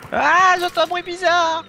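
voix lugubre. Acted. Bruit bizarre, voix lugubre.

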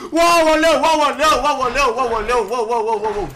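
loud repeated cries, falling from high; loud wailing outcry. Real, not acted. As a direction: wail it out loudly, gradually coming down. Loud outcry during game fight. (văicăreală tare, care coboară treptat)

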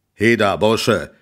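loud, slow, rough call from below; gruff farmer calling out; coarse farmer's shout. Acted. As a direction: bellow up at the boy in the tree. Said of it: "rief eine grobe Stimme".

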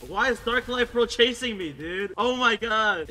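loud, high-pitched protest; exasperated complaint. Real, not acted. Gamer chased by another player, loud exasperation. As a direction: complain loudly while being chased.

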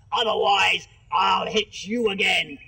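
loud menacing bark; threatening shout. Acted. Sheriff threatens the boy to keep him silent, angry shout. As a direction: make the threat ring out.

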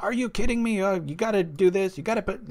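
high, incredulous retelling of his own outburst; hammed-up shocked complaint; mock disbelief. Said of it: "my knee-jerk reaction was like".

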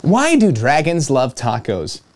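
ordinary delivery, nothing marked

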